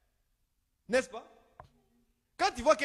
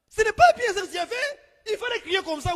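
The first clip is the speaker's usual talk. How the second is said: scolding rebuke; 10 semitones higher, 5 dB louder than usual. Acted. Rebuking hypocrite pastor.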